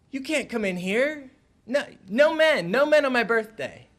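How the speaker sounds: put-on high, loud little girl's voice; a girl barring the door, loud and high; child's demand. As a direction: shout it out like a bossy nine-year-old. Girl tells him to stay out.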